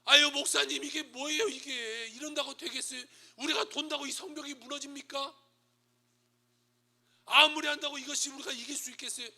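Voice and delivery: high, loud, exasperated protest; protesting complaint. Acted. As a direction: complain like a doubting church member. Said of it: "여러분에서 좋은 말 나올까요?".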